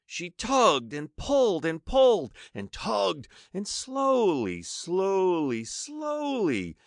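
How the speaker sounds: straining slow narration. A narrator's reading.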